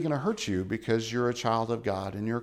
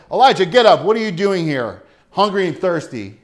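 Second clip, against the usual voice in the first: rousing call; 4 semitones higher, 9 dB louder than usual. Acted. God voiced rousing Elijah.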